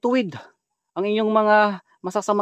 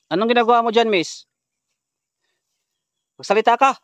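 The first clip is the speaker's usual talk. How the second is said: raised, demanding call; 6 semitones higher, 5 dB louder than usual. Real, not acted. Vlogger demands that the possessed woman speak, calling out. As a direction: press for an answer, higher and louder.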